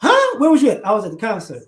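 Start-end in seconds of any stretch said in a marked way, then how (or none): 0.0-0.4 sharp high disbelieving huh; surprised huh. Acted. Friend reacting to news, high shocked huh.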